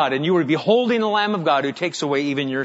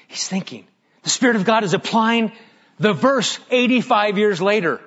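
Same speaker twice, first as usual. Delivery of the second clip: normal talking voice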